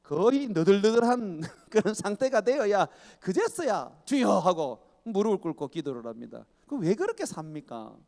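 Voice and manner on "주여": loud broken plea of "주여"; loud cry of surrender; desperate cry. Acted.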